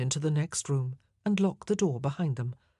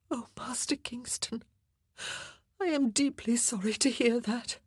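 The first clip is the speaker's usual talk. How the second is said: lamenting; 8 semitones higher than usual. A narrator's reading. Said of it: "Anne lamented".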